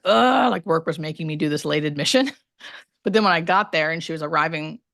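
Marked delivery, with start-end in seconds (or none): 0.1-0.5 loud, high annoyed ugh; annoyed groan. Retold or imitated. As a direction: groan like the job is a drag. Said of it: "I was like".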